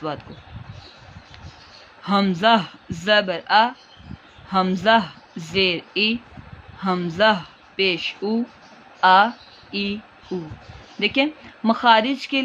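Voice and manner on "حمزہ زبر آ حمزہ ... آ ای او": حمزہ زبر آ spelled slowly and softly; slow soft drill. Shown as a demonstration. Teacher models the hamza vowels slowly for students. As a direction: recite each vowel gently, syllable by syllable. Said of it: "نرمی سے پڑھیں گے".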